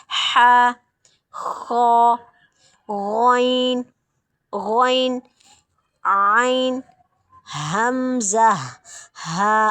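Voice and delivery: हा, खो, गौन, आइन, हमज़ा called out loud from the throat; reciting the throat letters loudly for students. Shown as a demonstration. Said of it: "हल के हरूफ हमें बहुत अच्छे से याद हो गए हैं".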